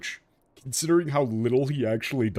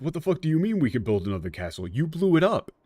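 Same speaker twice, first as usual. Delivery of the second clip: exasperated rant, higher and fast; mock outrage; 5 semitones higher than usual. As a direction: vent at him like you can't believe it. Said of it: "It's like".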